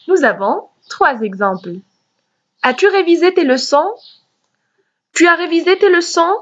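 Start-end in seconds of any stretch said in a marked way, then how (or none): 2.6-3.9 rising question tune on révisé; teacher demo of a question; question intonation. Shown as a demonstration. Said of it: "c'est l'intonation". 5.1-6.3 higher and fast, rising tune on Tu as révisé tes leçons?; teacher demo of an intonation question. Shown as a demonstration. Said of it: "c'est l'intonation".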